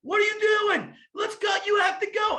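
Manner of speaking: high, loud, urging yell from the poolside; urgent mom shout. Retold or imitated. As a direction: shout at the kid to get moving and swim. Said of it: "Katie's like".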